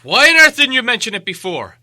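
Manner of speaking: loud, high, exasperated outburst; exasperated cry. A narrator's reading. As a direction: shout it at Bilbo in frustration. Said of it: "they cried".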